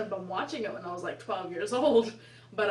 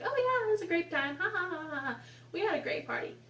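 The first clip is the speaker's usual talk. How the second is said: fake polite; 8 semitones higher, faster than usual. Fake pleasantries with parents.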